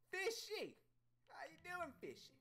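high, loud call to a pet; excited greeting. Pretend. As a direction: greet the fish in a high, playful shout.

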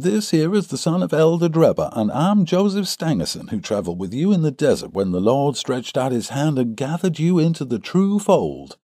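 high-pitched, pious character voice; young visitor Stangerson. A narrator's reading. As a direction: introduce yourselves in a high put-on voice.

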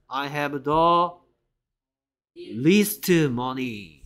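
least drawn out very slowly, long EE vowel; vowel shown. Shown as a demonstration. Teacher drilling "least" for class, very slow and clear. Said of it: "E 소리 내고 있습니다".

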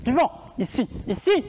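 shouted into an echoing hangar; empty warehouse echo. Real, not acted. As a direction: call out to hear the echo. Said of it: "quand on gueule, du coup, ça résonne". (écho de hangar désaffecté)

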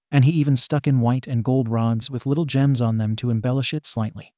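ordinary delivery

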